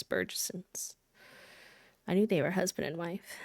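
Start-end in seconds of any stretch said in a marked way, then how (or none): 0.0-1.0 soft unvoiced whisper. Real, not acted. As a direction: breathe the name to yourself. Repeats name softly to self.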